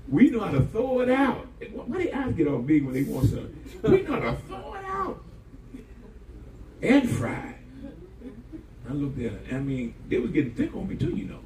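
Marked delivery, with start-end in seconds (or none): 3.8-7.5 high, laughing mimic of the excuse; laughing mock. Repeats line, laughing, high.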